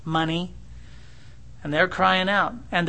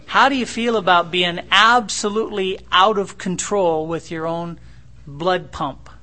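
louder, pressing the question home; forceful preaching; 5 dB louder than usual. Acted. Preacher pressing a proud son about his fear. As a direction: drive the question home hard.

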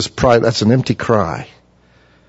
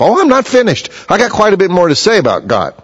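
high, eager voice, much higher; eager Elihu; 8 semitones higher than usual. Acted. Preacher voices Elihu begging to keep talking, high and eager. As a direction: play the keen young man who won't stop talking.

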